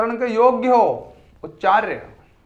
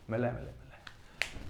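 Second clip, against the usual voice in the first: mock whispered answer; 8 semitones lower, 16 dB quieter, slower than usual.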